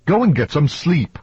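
acted woman at the end of her patience; exasperated order. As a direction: send him off in exasperation.

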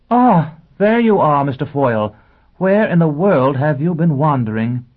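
loud, high teacher greeting; calling out brightly. Acted. Teacher calling to a straying pupil, loud and high.

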